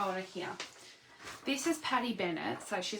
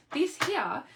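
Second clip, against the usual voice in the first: higher, louder salesy pitch; infomercial voice; 8 semitones higher, 7 dB louder than usual. Acted. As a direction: sell it like a TV ad host. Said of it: "I'm gonna sound like an infomercial".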